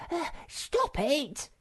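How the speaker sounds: high, slow whining cry; whiny stop it; squeaky protest. A narrator's reading. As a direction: wail it like a tugged pet. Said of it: "he shouted".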